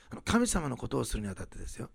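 usual speaking voice

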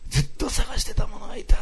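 high-pitched acted cry of discovery; heartfelt realisation. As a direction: voice her long search ending. Acts out her moment of realisation. (発見の感動を高い声で再現)

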